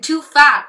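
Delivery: "too fat" hit hard and loud; punched phrase. Acted. Poet mocking celebrity gossip, loud stressed "too fat". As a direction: slam the words "too fat". Mocking what television says.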